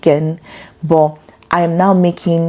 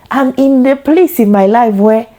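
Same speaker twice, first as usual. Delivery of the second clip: a hint of a Nigerian auntie accent, raised higher; light Nigerian auntie accent; 6 semitones higher, 3 dB louder than usual. Mother imagining herself auditioning as an auntie, light Nigerian accent. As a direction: playfully slip into a Nigerian African auntie voice. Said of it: "African auntie".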